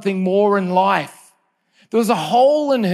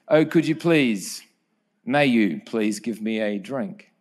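polite request; 6 semitones lower, slower than usual. Retold or imitated. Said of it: "He says to her".

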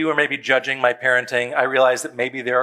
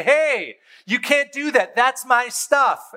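put-on whiny child protest; 8 semitones higher, slower than usual. Child protesting the garbage bag.

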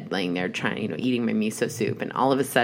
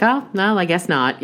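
mock disappointed; 8 semitones higher, 3 dB louder than usual. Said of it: "And he's like".

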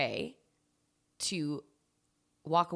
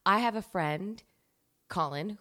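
ordinary talk

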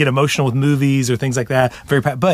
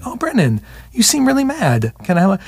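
gentle empathic voice; 8 semitones higher than usual. Retold or imitated. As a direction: mimic mom's tender empathy, much higher. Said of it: "'Cause you would be like".

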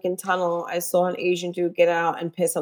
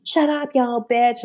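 lightly mocking teen voice, higher pitch; mimicked bratty teens; 5 semitones higher, slower than usual. Driver imitates teenagers who cut them off, mock bratty. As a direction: imitate entitled teenagers talking back.

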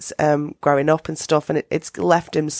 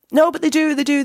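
imitating David, higher; 10 semitones higher than usual. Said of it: "And he's like".

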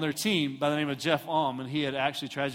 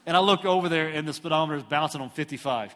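plain reading voice

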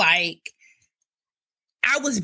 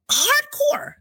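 high squeal. Retold or imitated. Squeal at the burning sensation.

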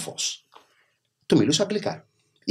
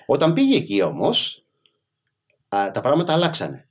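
ordinary talk, nothing marked

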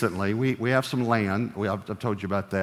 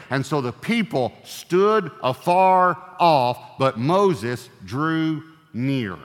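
slow scripture reading; 5 semitones higher, 5 dB louder, much slower than usual. Acted. Preacher reading scripture dramatically.

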